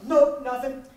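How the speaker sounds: loud quick brush-off; fast curt no. Retold or imitated. Brother shutting down the question, loud and fast. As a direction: snap it out fast. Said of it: "That's as fast as it comes".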